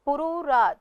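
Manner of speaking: ordinary delivery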